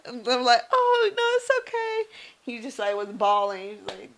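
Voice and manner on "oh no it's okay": squeaky high "it's okay", way up in pitch; awkward reassurance. Retold or imitated. Nurse awkwardly reassuring a sobbing patient, very high. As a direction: brush it off, sweetly flustered.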